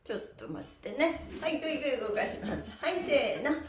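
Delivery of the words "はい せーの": ordinary talk, nothing marked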